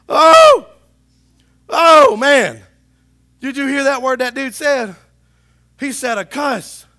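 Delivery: hammed-up outrage at a swear word; mock shock. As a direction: overplay a churchgoer shocked by a cuss word. Mocking shocked churchgoer.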